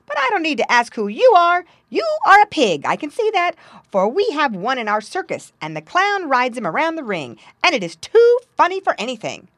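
high, loud lively monkey; excited, high-pitched monkey voice; merry monkey, amused. Acted. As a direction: chatter brightly as a circus monkey.

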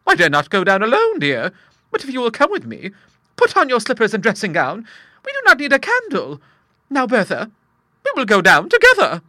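high, loud nervous coaxing; timid old lady. Acted. Monica coaxing Bertha downstairs, high and timid. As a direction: brave herself to go down.

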